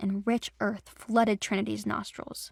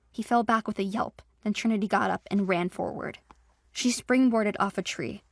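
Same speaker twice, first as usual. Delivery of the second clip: ordinary delivery, nothing marked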